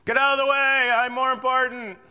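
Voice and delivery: loud, high shout of a rude driver; impatient driver's yell. Retold or imitated. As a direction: yell it like someone honking at you. Impatient driver honking, voiced.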